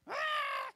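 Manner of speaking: sudden piercing high scream; child's scream. Retold or imitated. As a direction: let out a loud, high-pitched shriek imitating a screaming child. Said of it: "Screaming again".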